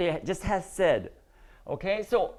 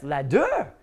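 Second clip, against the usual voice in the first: "Ladder" wrongly stressed as la-DER; weak first syllable in "Ladder", weight on DER; stress on last syllable; 6 semitones lower than usual. Shown as a demonstration. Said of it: "when you put that stress".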